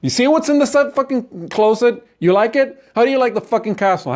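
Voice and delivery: high-pitched, goading questions; angry taunting. Acted. Acted taunting after the closet reveal.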